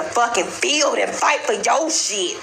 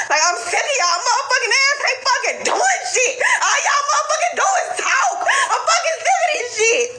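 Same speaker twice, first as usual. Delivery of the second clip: fed-up yell at the whole crowd; furious shout; 10 semitones higher than usual. Real, not acted. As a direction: shout your frustration through a laugh. Fed-up rant at passive viewers.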